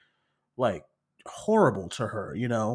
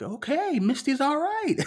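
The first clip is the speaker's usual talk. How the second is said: much higher, mock relief; high relieved squeak; 13 semitones higher than usual. Viewer relieved Misty escaped, high squeaky voice.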